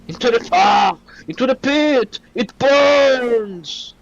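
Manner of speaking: defeated villain's high howl; mustache twirling villain. Pretend. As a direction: ham up a cartoon villain falling. Villain defeated, falling into pit.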